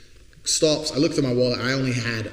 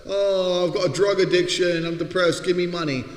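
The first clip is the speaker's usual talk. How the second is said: higher, whiny moaning; mock depressed beggar; 6 semitones higher, 4 dB louder than usual. Mocking a sitting beggar's excuses, whiny. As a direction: whine pathetically for money. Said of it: "like a dickhead".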